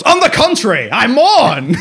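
high, loud hammed-up villain line; a campy bad guy mourning, high and loud; Bison impression. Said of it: "I love how insane he is".

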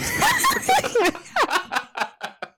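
squealing burst of laughter; big high laugh. Real, not acted. Loud laughing fit.

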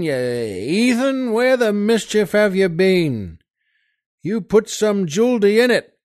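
loud, high barking calls across the camp; soldier shouting. Acted. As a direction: yell for the water boy, impatient. Soldiers berating water carrier.

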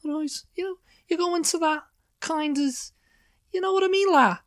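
cartoonish, high-pitched Scouse; high Liverpool voice. Shown as a demonstration. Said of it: "you gotta go into that high voice".

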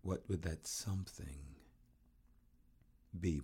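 hushed, whispery scary-story voice; spooky hush. Acted.

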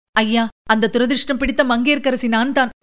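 ordinary delivery, nothing marked

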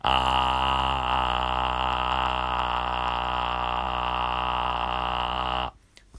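low rattling fry, vocal folds buzzing; low edge voice; relaxed vocal fry. Shown as a demonstration. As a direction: loose slow creak on ah. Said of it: "低い音で".

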